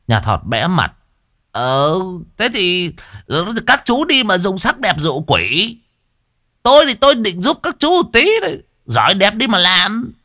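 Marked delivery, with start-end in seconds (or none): 1.5-10.1 sulky high voice. A narrator's reading. Thọt embarrassed retorts.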